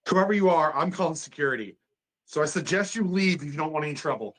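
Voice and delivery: shouted threat. Acted. Doctor calling security on the attacker, shouted threat. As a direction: warn the intruder off at full voice.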